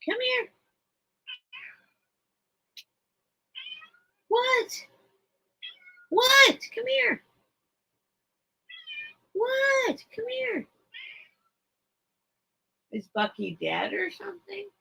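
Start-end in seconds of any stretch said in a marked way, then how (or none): 0.0-10.7 high singsong call to the bird; pet talk. Real, not acted. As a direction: coax the parrot over, pitched up. Calling to her pet bird.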